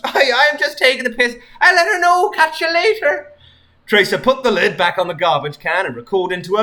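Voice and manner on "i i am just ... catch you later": high, loud breezy British sign-off; cheery British. Acted. Tracer reassures Sonic she's joking, breezy British. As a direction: wave him off in a jolly British accent. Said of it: "cheery British voice".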